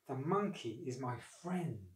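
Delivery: slow, soft brooding; troubled thought. A narrator's reading. Crocodile privately regretting his plan, troubled thought. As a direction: think it aloud, softly and slowly. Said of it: "he thought".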